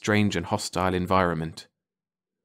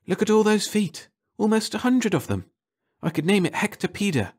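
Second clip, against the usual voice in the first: much higher, thrilled; delighted; 11 semitones higher than usual. Acted. Leakey marvelling at the creature, much higher. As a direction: gush with wonder.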